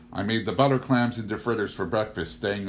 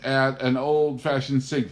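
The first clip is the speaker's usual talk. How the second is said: high, slow, gushing delight; mock-rapturous guests sighing over the sink; gushing city guests; 6 semitones higher, 8 dB louder, slower than usual. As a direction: gush like a charmed visitor from town. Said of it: "they said".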